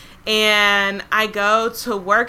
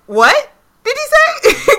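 high, slow, drawn-out "What did he say?"; stunned disbelief; 6 dB louder, slower than usual. Retold or imitated. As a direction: act stunned by the price. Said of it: "And I said".

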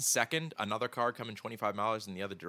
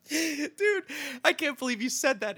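very high, helpless laughing voice; laughing in disbelief; slower than usual. Real, not acted. Cracking up at the co-host's crude guess, laughing in disbelief. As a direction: squeal it through real laughter.

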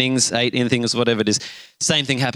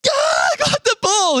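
very high, slow mock shriek of joy; hammed-up delighted shout; overexcited kid. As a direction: squeal it like an ecstatic little boy. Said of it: "He's so excited".

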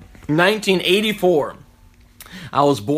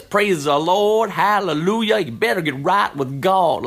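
put-on Southern preacher accent; 3 semitones higher than usual. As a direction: mimic a Southern preacher praising the Lord. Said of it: "southern accent like this".

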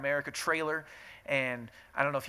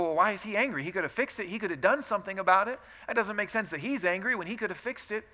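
higher-pitched challenge; puzzled objection; 6 semitones higher, 4 dB louder, faster than usual. Acted. Listener questioning why Jesus is angry, puzzled. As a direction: push back like a doubting listener. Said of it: "and you can go".

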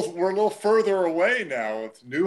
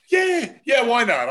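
much higher, shouted happy yeah yeah; gleeful yes; 8 semitones higher, 3 dB louder than usual. Retold or imitated. Offered more chowder, broadcaster shouts an excited high yes. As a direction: cheer like a kid offered seconds. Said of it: "David's like".

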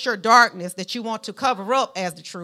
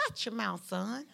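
soft, understated fatherly rebuke; quiet warning; 11 dB quieter than usual. Acted. God telling young Jeremiah off, quiet warning. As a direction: caution him under your voice, much softer.

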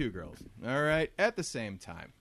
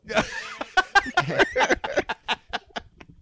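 loud laugh; 9 dB louder than usual. Real, not acted. Reacting to brothers joke.

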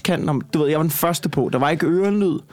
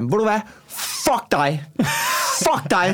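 snapping back at a heckler, higher pitch; retold angry shout; 6 semitones higher, slower than usual. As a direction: re-enact telling a heckler off. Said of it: "Så råber jeg bare".

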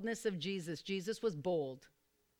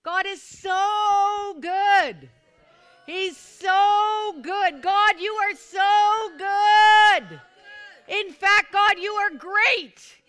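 slow, much higher and louder shouts with stretched words; full-throated praise; 11 semitones higher, 16 dB louder, much slower than usual. Real, not acted. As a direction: shout each praise to God at full force. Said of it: "You gotta say it".

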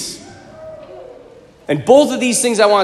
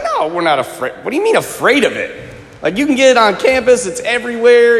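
mock cocky scoffing, pitched up; joking players; 6 semitones higher than usual. Cocky players answering their coach about drugs, jokey scoffing. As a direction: imitate cocky players brushing it off. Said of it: "they're just kind of just playing around".

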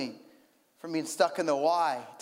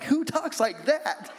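incredulous; 7 semitones higher, slower than usual. Retold or imitated. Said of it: "I'm like".

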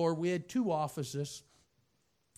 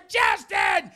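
loud, high angry shout; hollering; 11 semitones higher, 12 dB louder, slower than usual. Retold or imitated. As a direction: bark the order down the hallway. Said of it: "So I holler".

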